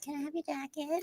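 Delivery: high, tiny, soft pleading voice; Angelina. Retold or imitated. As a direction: ask meekly in a small high voice. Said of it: "Angelina being like".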